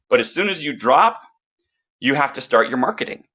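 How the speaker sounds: ordinary delivery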